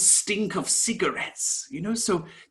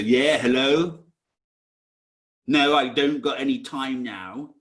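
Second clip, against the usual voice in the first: a hint of a gruff Cockney phone answer, a bit louder; lightly put-on Cockney; 3 semitones lower, 6 dB louder than usual. Job seeker answering the recruiter's call, lightly mock Cockney. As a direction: brush off the caller with a rough, louder Cockney "Yeah, hello". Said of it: "in your Cockney accent".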